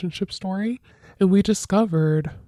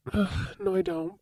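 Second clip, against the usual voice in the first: high, whiny "ugh, no"; whiny refusal; 12 semitones higher, 4 dB quieter than usual. Acted. Refusing her roommate's advice, in a high whine. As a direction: groan it out like a kid refusing.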